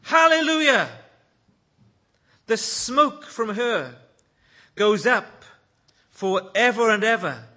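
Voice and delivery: loud, high hallelujah shout; shouting crowd. A narrator's reading. Heavenly multitude celebrating judgment, shouted out loud. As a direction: ring it out like a vast crowd. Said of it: "they shouted".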